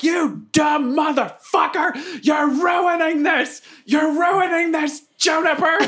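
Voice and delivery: high, drawn-out heckler's scream; hammed-up apoplectic yelling; outraged screaming. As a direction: shriek abuse from the crowd, stretching the words. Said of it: "I'm just screaming stuff at the Forsaken".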